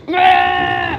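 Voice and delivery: high, loud wordless holler; loud dragging yell. Real, not acted. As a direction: yell out as you haul.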